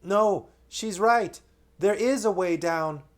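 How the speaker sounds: put-on high, loud, slow boyish call; young Edmund calling. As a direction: call down reassuringly. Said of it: "Edmund's voice saying".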